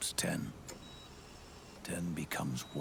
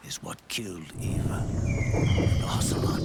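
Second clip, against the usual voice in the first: sudden much louder hard declaration; forceful revelation; 10 dB louder than usual. Acted. Father telling his son the fatal truth, forceful. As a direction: hit the truth hard and loud. Revealing the fox die's true toll.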